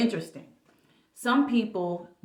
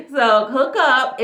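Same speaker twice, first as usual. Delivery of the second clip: "hook up" drawn out, louder and high through a laugh; laughing, spelling it out; 8 semitones higher, 11 dB louder, slower than usual. Real, not acted. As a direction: say "hook up" slowly with an embarrassed laugh. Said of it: "let me just spell it out".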